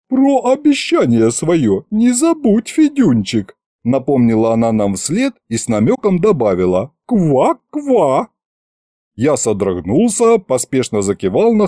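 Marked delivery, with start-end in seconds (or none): 0.1-3.6 sly witch reminder. Acted. Witch reminds Fedya slyly. 7.1-8.3 teasing croak. Acted. Witch teases with frog threat.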